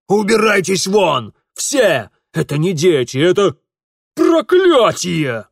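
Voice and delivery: loud, high exasperated shout; furious shouting. Acted. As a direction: drive them all out, ending on a drawn-out cry. Father throws children out.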